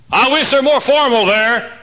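high, loud mock complaint; complaining churchgoer. Visitor objecting to the church's joy, loud mocking imitation. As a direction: mimic a disapproving visitor at high pitch. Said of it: "folks come to our church and say".

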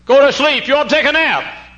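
high, loud acted shout; exasperated fatherly order. Worried father ordering his son to sleep, acted shout.

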